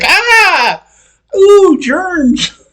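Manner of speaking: very high squealed mock terror; cartoonish high-pitched scream of disgust; mock horrified shriek. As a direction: shriek like a germophobe, hammed-up. Mocks people recoiling from thrown mask.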